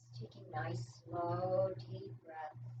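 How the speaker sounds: soothing yoga teacher settling the class into stillness; guided relaxation. Acted. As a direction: ease them into letting go, hushed and unhurried. Leads breathing relaxation.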